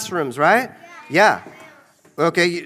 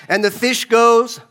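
ordinary delivery

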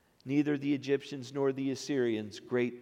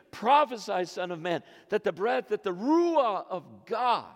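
higher, louder, building sermon delivery; impassioned preaching; 7 semitones higher, 3 dB louder than usual. Acted. Preacher proclaiming God's command to the congregation, impassioned. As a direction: proclaim the breath of God over the bones.

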